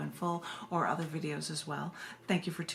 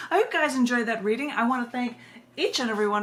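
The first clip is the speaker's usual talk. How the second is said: upbeat sign-off; 4 semitones higher, 6 dB louder, faster than usual. Real, not acted. Bright sign-off to viewers.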